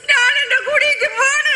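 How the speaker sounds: tearful high shout; crying shout. Acted. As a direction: wail that you're leaving. Wife storms off crying.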